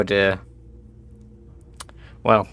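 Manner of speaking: ordinary delivery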